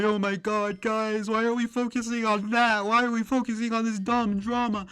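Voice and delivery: high, whiny mock complaint, pitched well up; mocking whine. Mocking a streamer's complaint, high whiny voice.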